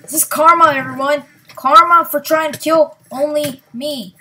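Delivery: gloating shout. Real, not acted. Gamer shouting at rivals after getting revenge, loud and gloating. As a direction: crow over the players who hunted you.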